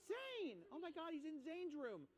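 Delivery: put-on high panicked kid shout; hammed-up frightened kids; mock panic. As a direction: yell in hammed-up alarm. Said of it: "So the kids are like".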